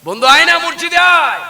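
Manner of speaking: sing-out invitation; calling a friend. Retold or imitated.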